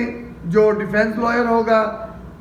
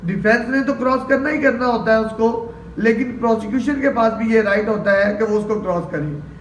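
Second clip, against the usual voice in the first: usual speaking voice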